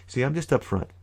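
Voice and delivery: normal talking voice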